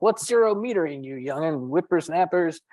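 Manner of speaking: put-on grumpy old geezer scolding youngsters; old man voice. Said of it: "I also sound like an old man".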